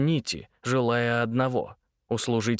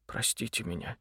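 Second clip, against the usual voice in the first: much softer, barely voiced apology; almost whispering; 2 semitones lower, 10 dB quieter than usual. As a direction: breathe the apology out. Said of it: "почти прошептал он". (почти шёпотом)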